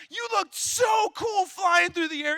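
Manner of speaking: big brother hyping up the crying kid, mock excited; mock hyped praise. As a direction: pump him up so he stops crying. Flattering brother to stop crying.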